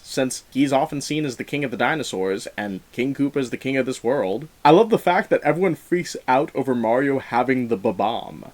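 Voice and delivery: normal talking voice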